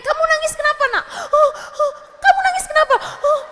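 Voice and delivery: very high, loud hammed-up questioning; mock badgering mother. Mother grilling a crying child for reasons, mock badgering. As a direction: imitate a frantic mother pestering a sobbing child. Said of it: "Jangan juga ditanyain".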